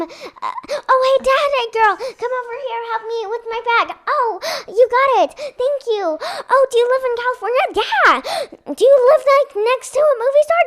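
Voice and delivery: high, loud, breathless kid playing a twin; rushed high-pitched chatter; excited twin girl. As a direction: gush like a girl meeting her new friend. Child plays twin meeting.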